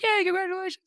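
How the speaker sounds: very high-pitched imitated congratulations; excited cheer. Old friend hearing of the talk invitation, imitated high-pitched cheer. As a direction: squeal it like a delighted friend.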